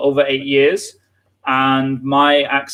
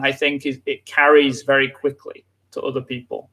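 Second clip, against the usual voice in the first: usual speaking voice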